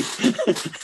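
a high burst of real laughter. Real, not acted. Laughing at his own story.

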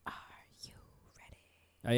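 slow whisper, word by word; playful ASMR whisper. Pretend. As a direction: whisper it teasingly, spacing out each word. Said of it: "No A S M R voice".

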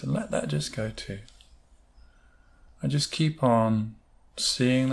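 unhurried meditation voice, long pauses between phrases; guided meditation. Acted. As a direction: lull listeners as they picture it drifting away. Leads a guided visualisation.

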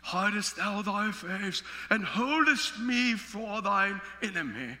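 slow, measured King James reading; grave prayerful recitation; solemn scripture reading. Acted. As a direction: intone it like an old Bible verse. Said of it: "He went on to pray".

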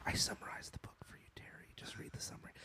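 hushed whispered quote; whispering. Pretend. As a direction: whisper it like a sly aside. Imagined producer telling Terry.

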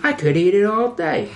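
high, gushing suck-up voice; eager kiss-up. Acted. Lalo answering Gus about the chicken, gushing. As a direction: fawn over the boss in a high pitch. Acts out eager-to-please employee.

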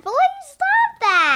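high, slow whiny please stop that; annoyed plea. Real, not acted. Daughter whining at her dad to stop interrupting. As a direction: drag it out, fed up with dad.

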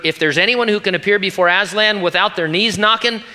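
a hint of a fussy, knowing character; Missus Beaver. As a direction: read it as an emphatic character line. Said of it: "said Missus Beaver".

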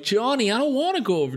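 put-on a nervous girl hanging back; scared teen girl. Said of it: "the girl's like".

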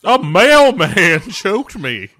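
mock frantic lady; hammed-up victim. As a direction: overplay a shocked old lady. Playing a movie character.

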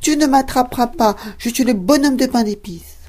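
high, loud sing-song taunt; cheeky cookie shouting back over his shoulder; gingerbread man taunting. Acted. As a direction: tease them from a safe distance, high and bright. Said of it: "répondit".

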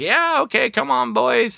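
high, hammed-up Sandler voice; mock Sandler. Sandler mocked rounding up his friends, high hammy voice. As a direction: sing out a lazy rally to the boys. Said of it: "Adam Sandler's like".

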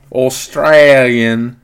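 hammed-up, very drawn-out Australian accent; Aussie accent. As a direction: stretch "Australian" slowly in a mock Aussie twang. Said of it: "Is that my Aussie accent".

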